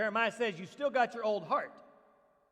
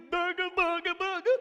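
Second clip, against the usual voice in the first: higher, louder mock spooky booga; spooky mockery; 7 semitones higher, 5 dB louder than usual. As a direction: tease like a silly ghost noise. Mocking clueless listener.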